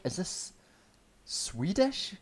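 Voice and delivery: high, soft, puzzled query; baffled question. Retold or imitated. Viewer puzzling over the villain's accent, baffled question. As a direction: raise it up in bewildered disbelief.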